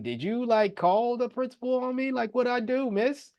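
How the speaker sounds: high-pitched, acted-out questioning; baffled appeal. Retold appeal to the English teacher.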